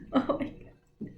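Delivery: breathy giggle through the words; oh my God whispered mid-giggle; laughing whisper. Real, not acted. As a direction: laugh it under your breath. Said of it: "I'm just laughing because".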